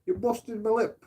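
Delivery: high whiny mock threat; mock girlfriend. Mocking girlfriend threatening police over her lip, high whiny. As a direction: whine it like an indignant girlfriend. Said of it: "She's like".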